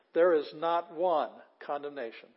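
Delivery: "not one" hit loud; stress on NOT ONE. Shown as a demonstration. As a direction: lean hard on "not one", then ease off. Repeating verse with emphasis.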